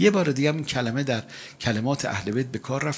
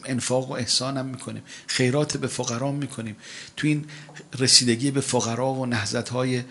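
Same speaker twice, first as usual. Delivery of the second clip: ordinary delivery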